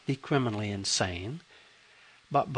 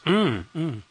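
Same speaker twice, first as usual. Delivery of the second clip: put-on old man voice; 3 semitones higher, 3 dB louder than usual. A narrator's reading. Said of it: "with his feeble old man voice".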